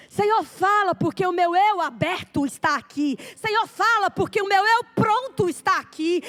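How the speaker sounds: fervent prayer that builds, then slows to a plea; building prayer. Acted.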